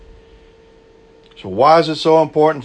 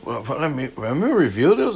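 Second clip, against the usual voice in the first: mock surprise; 6 semitones higher than usual. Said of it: "I looked at that and I was like".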